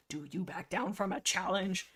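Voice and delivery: usual speaking voice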